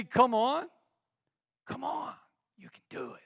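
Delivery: gentle encouragement. Shown as a demonstration. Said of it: "Same words, different communication".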